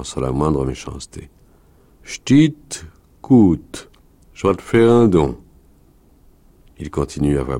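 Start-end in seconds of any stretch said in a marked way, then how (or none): 2.0-5.4 high, loud rustic character voice; wolf leader addressing child. Acted. Wolf leader promises a little girl a gift, insistent. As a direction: call the child's attention in raised, separated phrases.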